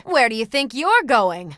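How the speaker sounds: taunting challenge. Acted. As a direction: call out a retreating enemy. Taunting fleeing enemy.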